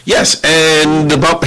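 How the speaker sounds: startled back to attention, stammering; flustered confusion. Retold or imitated. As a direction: caught off guard, high and scrambling. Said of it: "I'm like".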